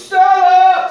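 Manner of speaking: high, loud howled name; imitating a yell. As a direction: yell it like the movie hero. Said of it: "and he goes".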